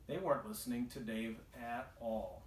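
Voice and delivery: usual speaking voice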